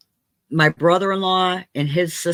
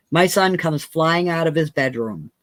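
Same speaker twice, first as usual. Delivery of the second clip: ordinary delivery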